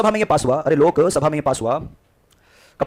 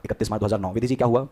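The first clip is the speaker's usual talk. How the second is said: ordinary talk, nothing marked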